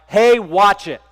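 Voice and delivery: a sharp, loud warning shouted at the truck; harsh loud retort. Retold or imitated. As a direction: snap it out loud like a startled runner. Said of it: "Said it with a very harsh tone, very loudly".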